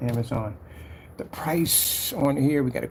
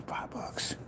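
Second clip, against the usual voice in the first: usual speaking voice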